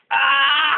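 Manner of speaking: sharp high cry of pain; pained yelp. Real, not acted. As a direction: yelp as the pellet hits. Hit by BB gun shot.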